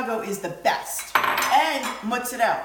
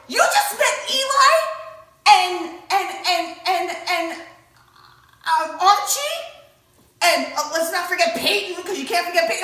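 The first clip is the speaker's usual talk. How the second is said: high, loud amazement; listing them off in astonishment; stunned fan running down the names; excited disbelief; 6 semitones higher, 6 dB louder than usual. Retold or imitated. Disbelief at mother's celebrity encounter.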